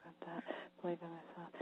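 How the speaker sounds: tiny whispered note to oneself; whispering. Real, not acted. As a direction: whisper it inwardly, hardly audible. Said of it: "if I'm whispering here".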